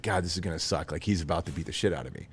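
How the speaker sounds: low, soft aside of dread; inner thought. Retold or imitated. Thinking before the bigger guy beats him, inner dread. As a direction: drop down to a resigned private thought. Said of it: "thinking in my head".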